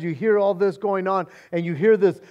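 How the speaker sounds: normal talking voice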